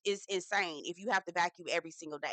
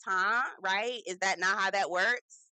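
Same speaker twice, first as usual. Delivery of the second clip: normal talking voice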